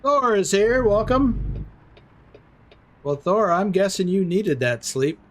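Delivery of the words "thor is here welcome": excited greeting. Real, not acted. Greeting a viewer arriving in chat.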